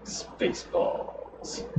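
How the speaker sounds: very slow, hushed stage whisper; drawn-out whisper. Acted. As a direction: whisper SPACEBALLS, stretching it right out. Echoes film title in hushed voice.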